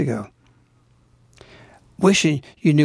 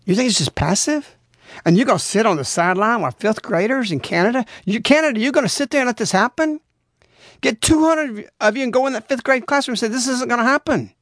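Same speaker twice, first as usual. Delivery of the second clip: higher, urgent preacher's challenge; fiery preaching; 7 semitones higher than usual. Acted. Preacher urging listeners to confront the classroom, fiery. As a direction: rouse the congregation to act.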